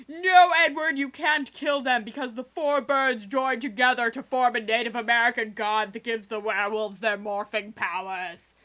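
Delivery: loud, slow solemn warning; stern father warning. Retold or imitated. Dad stopping Edward from killing the birds, loud and slow. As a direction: stop him with grave authority. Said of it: "his dad is like".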